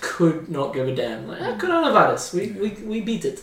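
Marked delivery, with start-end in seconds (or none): 1.4-3.4 put-on Eastern European accent, high start; lightly done Belarusian accent. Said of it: "that's your Belarusian accent".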